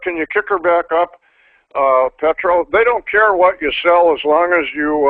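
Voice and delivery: ordinary delivery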